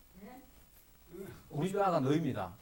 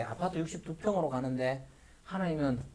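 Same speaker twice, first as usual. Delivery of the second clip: ordinary talk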